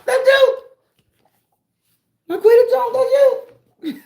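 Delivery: very high, loud squealing sing-song; squealing tease. Real, not acted. Streamer teasing a viewer about her name, squealing high and loud.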